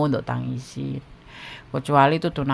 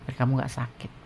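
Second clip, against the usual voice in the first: ordinary delivery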